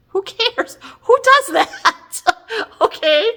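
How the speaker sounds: high, laughing scoff; mocking laugh. Real, not acted. Mocking the reunion premise.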